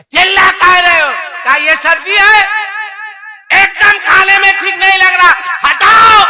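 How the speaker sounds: high and loud quarrel; angry shouting. Acted. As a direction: yell back at each other.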